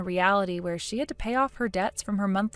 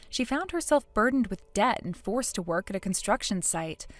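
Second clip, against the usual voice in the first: plain reading voice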